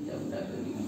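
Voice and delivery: breathy whisper, resigned husband; whispered acceptance. Retold or imitated. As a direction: give in under your breath. Calm husband accepting his wife.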